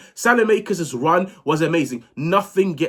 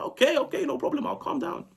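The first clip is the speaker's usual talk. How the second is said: meek reply; 11 semitones higher, 6 dB quieter than usual. Retold or imitated. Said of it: "I was like".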